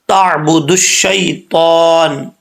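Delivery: slow recited تعبد الشیطان. Shown as a demonstration. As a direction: recite it slowly. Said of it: "آگے ہے جی". (آہستہ تلاوت)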